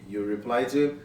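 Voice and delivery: usual speaking voice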